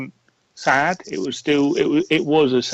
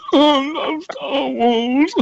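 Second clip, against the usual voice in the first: high, slow mock sob; hammed-up crying fan; 13 semitones higher, much slower than usual. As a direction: blubber it like an overjoyed fan. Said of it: "I was like".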